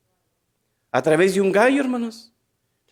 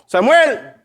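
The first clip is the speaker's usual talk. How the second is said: calling out; 4 semitones higher, 4 dB louder than usual. Acted. God calls Samuel again.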